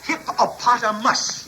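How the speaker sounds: "Hippopotamus" said slowly, every syllable clear; correct pronunciation. Shown as a demonstration. Charlie corrects the Baron, saying "Hippopotamus" slowly. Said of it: "would you say that again".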